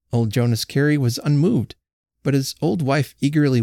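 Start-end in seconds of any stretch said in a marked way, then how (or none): none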